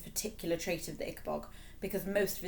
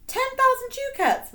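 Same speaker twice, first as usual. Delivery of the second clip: much higher, louder astonished king; shocked repetition; 10 dB louder than usual. A narrator's reading. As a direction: gasp at the price. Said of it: "repeated King Fred".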